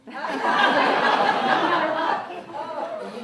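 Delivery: usual speaking voice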